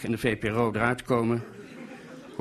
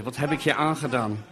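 usual speaking voice

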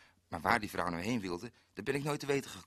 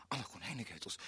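dismayed mutter; 12 dB quieter, faster than usual. Acted. Lost alone at night.

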